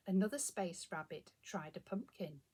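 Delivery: plain reading voice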